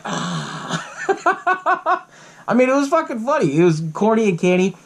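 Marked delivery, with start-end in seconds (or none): 0.0-0.8 low, soft creature exhale; imitated flasher gasp. Retelling the gremlin flashing in sunglasses, low imitated ah. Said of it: "he flashes like". 0.9-2.0 high cackling giggle; high laughing. Real, not acted. Retelling the flasher gremlin, real high giggles.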